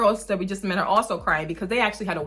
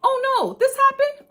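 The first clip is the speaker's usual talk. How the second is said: a squeaky, higher mom yelp of mock alarm; mock panicked mom; 5 dB louder than usual. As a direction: play the flustered mom, pitch jumping up. Mom voicing a kid mishap.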